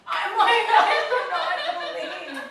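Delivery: high, very loud exclamation; disbelieving shout. Retold or imitated. Horrified she gave driver her card, disbelieving shout. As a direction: cry out in hammed-up disbelief.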